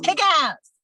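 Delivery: loud, high exclamation; punchy, high-pitched whoop of encouragement; rousing cheer. Real, not acted. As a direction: blurt it out like a rallying cry. Shouts out blunt advice, then laughs.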